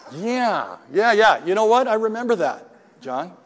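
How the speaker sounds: grinning yes-yes to the sponsor; eager admission. Retold or imitated. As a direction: blurt it out, caught and admitting it. Sheepishly admitting to sponsor.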